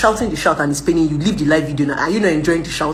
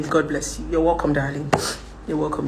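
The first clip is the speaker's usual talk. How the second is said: ordinary talk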